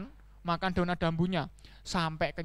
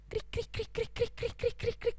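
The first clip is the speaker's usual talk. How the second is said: tiny, high clipped chirps; squeaky, soft cricket imitation; cricket chirping; 13 semitones higher, 9 dB quieter than usual. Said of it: "ada suara jangkrik".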